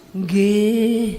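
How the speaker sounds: long, compliant Javanese "nggih"; mock-dutiful wifely assent; obedient Javanese wife. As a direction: answer submissively, stretching the word out. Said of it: "Istrinya, nggih".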